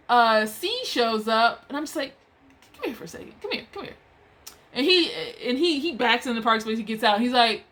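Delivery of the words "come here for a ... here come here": soft, higher-pitched beckoning; discreet call over. Retold or imitated. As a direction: beckon someone quietly, imitated. Discreetly calling someone over in story.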